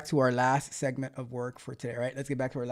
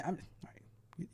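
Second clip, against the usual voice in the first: breathless whispered aside; 3 semitones higher, 17 dB quieter, slower than usual. Real, not acted. Teasing aside to chat.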